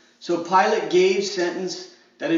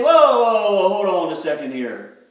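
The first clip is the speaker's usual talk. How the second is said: startled whoa; 5 dB louder than usual. Pretend. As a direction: pull back in alarm. Believer balking when God asks.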